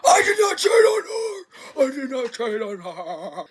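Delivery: hammed-up crying protest; mock sobbing denial. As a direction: sob out a desperate denial. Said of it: "And he's like".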